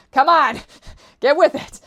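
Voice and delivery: loud, high playful holler; mock scolding shout. Vlogger chiding the absent Canadian audience, mock shout. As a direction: holler it at them, half-joking.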